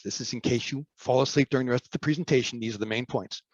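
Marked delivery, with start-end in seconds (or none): none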